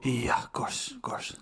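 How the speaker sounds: whispered Pep, fading away; hushed mock Pep Guardiola; Pep Guardiola impression. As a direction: breathe it out in a whisper. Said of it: "Pep Guardiola impression".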